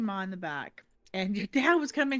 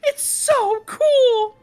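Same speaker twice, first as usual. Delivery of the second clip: usual speaking voice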